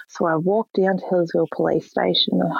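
ordinary delivery

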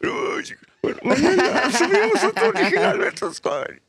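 high, laughing, cheerful brush-off; laughing reply. Retold or imitated. Answering a fan's touching message, laughing.

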